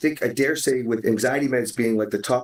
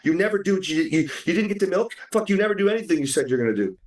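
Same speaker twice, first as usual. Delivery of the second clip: higher, fast, fed-up angry rant at the spouse; angry outburst; 7 semitones higher, faster than usual. Acted. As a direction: blow up in frustration, spitting the words out. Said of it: "versus reacting and saying".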